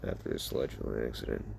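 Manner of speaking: very low, soft, quick line; deep voice. Acted. Son confronting his father, voice turning deep. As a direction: drop right down into a deep voice. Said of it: "As you'd get a deep, deeper voice".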